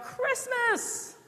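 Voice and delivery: high-pitched mock shout of excited children; imitated kids' shout. Preacher mimicking kids' answer to "what's coming", high mock shout. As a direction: cry it out very high, like kids answering. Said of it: "And they shout".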